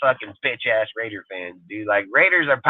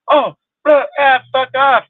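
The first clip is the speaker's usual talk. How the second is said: much higher, louder mock yell; imitated fan yell; 13 semitones higher, 5 dB louder than usual. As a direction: mimic a fan yelling back at you. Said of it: "now they'll yell at me".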